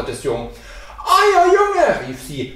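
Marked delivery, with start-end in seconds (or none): 1.0-2.1 high wailing shout; loud outcry. A narrator's reading. Wife runs to the boy's father, loud high outcry. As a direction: cry out in alarm, loud and high. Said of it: "mit lautem Geschrei zum Vater".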